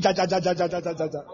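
rapid repeated juddering syllables; comic teeth-chattering stutter; chattering jaw. Retold or imitated. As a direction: imitate a jaw shaking uncontrollably. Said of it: "your jaw will be shaking".

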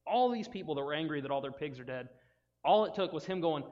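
normal talking voice

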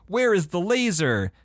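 mock-heroic questioning, high and slow; game hero's line. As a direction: recite bad game dialogue dramatically. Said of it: "you ask Ultron".

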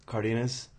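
Cardenas with a "dee" middle and buzzed ending; Cardenas, one variant. Shown as a demonstration. Offering co-host one way people say it, Cardenas as "KAR-dee-nuhz". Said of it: "some people say".

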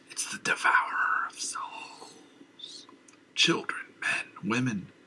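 hushed frantic whisper; madman whisper. Acted. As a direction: breathe it out in terror.

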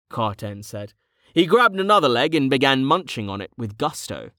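usual speaking voice

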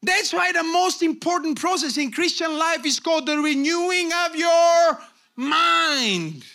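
loud, high preacher shout, stretching the final words; full-force preaching. Real, not acted. Preacher urges congregation to renew the mind, shouting. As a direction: drive each phrase home at full voice.

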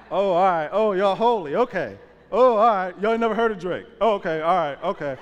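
loud, high, teasing mock hurt; mock offended. Real, not acted. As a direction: tease the crowd for pretending not to know. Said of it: "y'all gonna act like y'all ain't heard".

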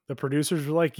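ordinary delivery

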